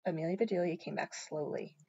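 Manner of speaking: ordinary delivery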